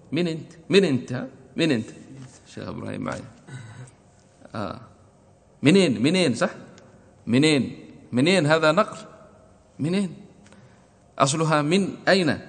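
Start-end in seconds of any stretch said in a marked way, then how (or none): 0.1-1.9 put-on Egyptian accent, higher pitched colloquial من أنت; Egyptian dialect. Teacher imitating the Egyptian dialect. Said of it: "وباللهجة المصرية يقول". 5.6-12.4 Egyptian منين, high and quick; Egyptian dialect. Retold or imitated. Teacher imitating the Egyptian dialect. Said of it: "وباللهجة المصرية يقول".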